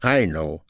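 "I" stressed above "know" in "I know"; emphasized I. Shown as a demonstration. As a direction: punch the "I" to set yourself apart. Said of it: "raise your voice to emphasize I".

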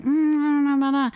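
skeptical. Retold or imitated. Skeptical police quoted in story.

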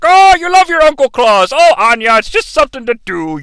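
high, loud character voice; hammed-up Uncle Claus. Uncle Claus explains himself to Anya, high hammy voice. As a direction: wheedle it as a jolly old uncle.

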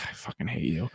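quiet sulky grumble; sullen son. Retold or imitated. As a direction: spit it under your breath at mom. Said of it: "And he's like".

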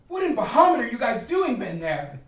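baffled character, pitch climbing sharply; exasperated bewilderment. Acted. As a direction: demand to know what is going on. Game master voices startled character arriving.

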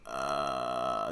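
low, quiet pained zombie moan. Retold or imitated. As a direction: let air groan out of the throat. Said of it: "they sounded more pained in".

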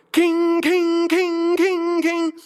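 high piercing imitation of a cymbal crash; shrill childlike king king, way up high; kid banging cymbal. Pastor imitating kids banging the church cymbal, high and piercing.

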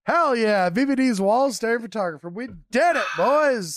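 loud, high celebration; excited cheer. Real, not acted. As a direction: cheer it like a win. Celebrating hiring a new employee.

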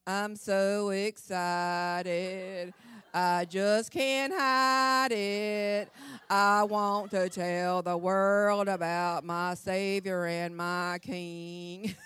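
very slow, drawn-out nervous singing; nervous singers. Retold or imitated. Preacher imitates a nervous visiting group singing very slowly. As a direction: stretch each word like a terrified church group. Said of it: "They were like".

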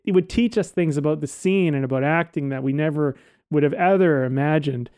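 ordinary talk

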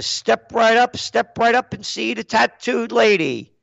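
high, chanting sideshow pitch; old-time circus come-on; circus barker. Acted. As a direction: call the crowd in like a carnival barker.

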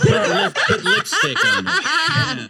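cracking up while talking; laughing. Real, not acted. Laughing through line.

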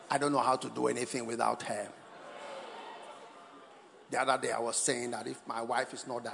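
very low, measured tribute; deep-voiced, earnest-sounding mock speech; mock devoted husband. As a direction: put on a deep solemn husband's voice. Acted husband's solemn party tribute.

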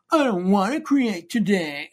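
high, whiny complaint; hyper whiny. Pretend. Mocking her own creative block, hyper whiny voice. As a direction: whine like a sulky child. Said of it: "hyper whiny".

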